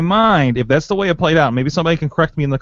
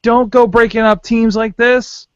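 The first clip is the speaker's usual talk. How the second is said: excited pleading; 9 semitones higher than usual. Real, not acted.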